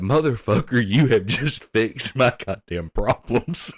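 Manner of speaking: loud laughing relief; a relieved coworker thanking him, laughing loudly. Retold or imitated. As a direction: shout it out through laughter.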